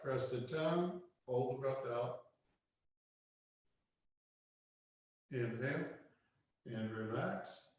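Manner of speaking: soft, quiet breathing instructions; guided meditation. Acted. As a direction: guide the breath gently and softly. Guiding breath practice.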